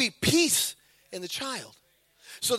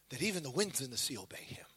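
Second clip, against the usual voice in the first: lower, voiceless, stunned whisper; hushed, much lower whisper of wonder; awed whisper; 10 semitones lower, 14 dB quieter, faster than usual. Retold or imitated. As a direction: breathe it in fearful awe. Awed disciples after the calm.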